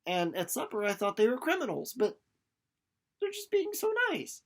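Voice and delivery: put-on high, wondering girlish voice; awed Winnie. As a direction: marvel at how nice they are. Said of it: "She's like".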